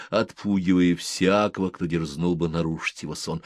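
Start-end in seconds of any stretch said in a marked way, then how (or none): none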